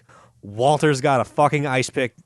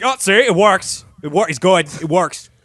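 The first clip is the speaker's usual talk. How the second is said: higher, louder cocky doctor boasting; gleeful mad surgeon, pleased with himself; smug Walter; 3 semitones higher, 4 dB louder than usual. Retold or imitated. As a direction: brag it like an unstoppable quack. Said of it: "So Walter's like".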